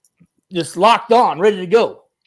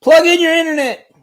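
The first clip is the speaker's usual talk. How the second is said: shouting; 12 semitones higher, 8 dB louder than usual. Real, not acted. Shouting at host over bad connection.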